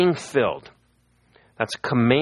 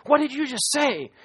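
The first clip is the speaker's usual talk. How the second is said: the stunned neighbour blurting out; startled man. Retold or imitated. Man bursts from his house at the stranger, startled high cry. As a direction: voice jumps much higher in shock. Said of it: "this guy comes running out of his house and says".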